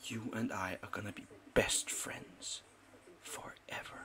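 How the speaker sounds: soft whisper to the dog. Real, not acted. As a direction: breathe it like a secret promise.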